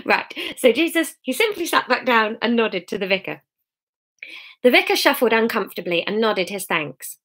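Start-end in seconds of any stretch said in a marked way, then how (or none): none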